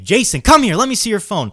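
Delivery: put-on high-pitched mother calling her kid over; imitated mom summoning son. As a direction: act out a mom demanding obedience.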